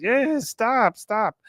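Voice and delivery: high-pitched mocking plea to stop; mocking echo. Con mimics the flirting couple.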